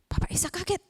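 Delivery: plain reading voice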